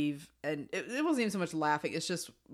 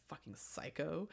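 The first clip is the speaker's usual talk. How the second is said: muttered insult; 12 dB quieter, slower than usual. Retold or imitated. Fleabag's muttered verdict.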